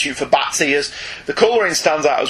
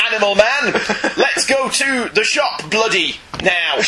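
high, slow, laughing mock Cockney; 6 semitones higher, slower than usual. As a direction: ham up a Mary Poppins chimney sweep.

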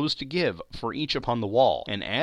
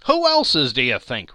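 scornful; 8 dB louder than usual. A narrator's reading. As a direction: sneer it at Joe. Woman scornful.

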